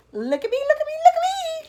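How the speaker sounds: very high, loud excited shout. Acted. Centipede shouting with joy at being free, high and loud. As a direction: shout with glee. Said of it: "shouted the centipede excitedly".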